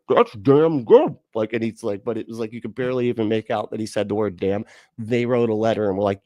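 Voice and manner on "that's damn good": commercial guy. Retold or imitated. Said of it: "And he was like".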